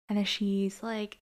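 ordinary talk, nothing marked

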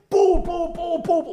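loud punching sound effects; punch noises. Retold or imitated. Acting out punching the thief, loud mock blows. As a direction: smack out each blow with your mouth.

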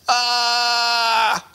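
long, high, loud "ah" of mock horror; hammed-up wail, held on one high note; mock agonized scream. Recoils at a sight, mock agony.

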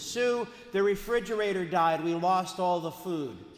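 loud, high panicked announcement; high frantic cry over a dead fridge; frantic shout. Retold or imitated. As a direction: burst in yelling the bad news. Dad bursts in, panicked.

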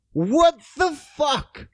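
a long, slow, high "what the fuck"; drawn-out disbelief. Real, not acted. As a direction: let the disbelief stretch out. Reacting to non-English print.

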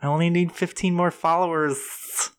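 put-on high, slow girly whine; influencer girl voice. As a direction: beg like an influencer. Mocking influencer.

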